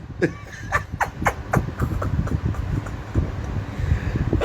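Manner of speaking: very high airless laugh; helpless silent laughter; breathless laugh. Real, not acted. Vlogger helpless with laughter at the circus ending, airless high laugh.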